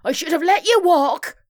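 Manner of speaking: high, loud, cross retort; fuming. A narrator's reading. Violet regrets giving Muriel a lift, fuming. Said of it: "fumed Violet".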